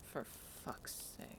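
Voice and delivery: breathy under-the-breath curse, slow; fed-up whisper aside; exasperated mutter. Acted. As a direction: mutter it so only Briar hears. Bex mutters at Briar's fussing.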